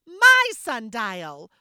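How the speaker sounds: loud, slow hammed-up cry; dramatic exclaim. Leah dramatically claiming the sundial in front of family, loud slow cry. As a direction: overplay the loss for show. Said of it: "Leah exclaimed dramatically".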